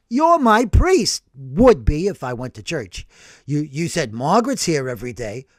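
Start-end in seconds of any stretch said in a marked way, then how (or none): none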